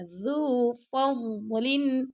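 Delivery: slow, melodic tajweed chant; teacher chanting a verse for students; Quran recitation. Shown as a demonstration. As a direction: recite it slowly with full tajweed. Recitation demo.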